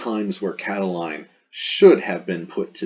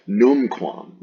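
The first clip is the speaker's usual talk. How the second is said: Numquam louder and higher, falling; emphatic numquam; 4 semitones higher, 6 dB louder than usual. Shown as a demonstration. Teacher reading Cicero, emphatic numquam. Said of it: "that's an emphatic right there".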